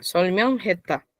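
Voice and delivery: "Solmyeon" lifted, then "jeta" falling; split two and two. Shown as a demonstration. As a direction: break between "Solmyeon" and "jeta". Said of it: "Parto la pronunciación de dos en dos".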